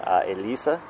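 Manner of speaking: usual speaking voice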